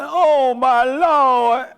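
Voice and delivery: high, loud mock panic; panicked disciples. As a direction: cry out to Jesus in a hammed-up fright. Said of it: "They were like".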